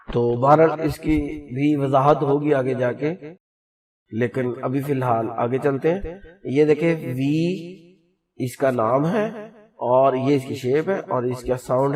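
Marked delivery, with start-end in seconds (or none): none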